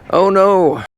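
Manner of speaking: a sudden high cry of dismay. Acted. Realizing they drive on the left, a high dismayed cry. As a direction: blurt it out, mock horrified.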